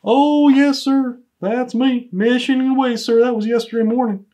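high, eager report to the boss; proudly eager. Acted. Proudly owns up to his mission.

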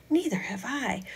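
whispered agreement. Acted. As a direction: hush so he can sleep. Hushed reply about sleeper.

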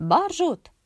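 high, loud astonished question; surprised. A narrator's reading. Doctor finds the woman at his door, loudly surprised. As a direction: recognize her with a jolt. Doctor recognizes late night visitor.

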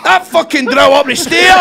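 a laughing, exaggerated outburst; mock rant. Real, not acted. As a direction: bellow it like the drill is driving you mad. Rant about noise upstairs.

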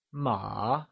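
"ma" soft, dipping and rising; falling-rising tone. Shown as a demonstration. Teacher offers a quiz choice, "ma" in falling-rising tone. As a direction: let "ma" sink, then lift it. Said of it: "falling rising tone".

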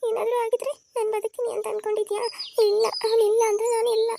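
high, crying insistence; tearful protest. Acted. Sister telling her friend she cannot live without her brother, tearful. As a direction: protest through tears.

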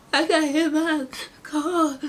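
slow, high, tearful words; crying. Retold or imitated. Crying girl telling her uncle she was hit. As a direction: get it out through sobs. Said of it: "and I'm crying".

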